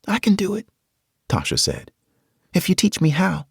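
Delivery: put-on high young girl's voice; determined girl. Tasha offering to carry a rifle, high girl's voice. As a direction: voice a brave kid.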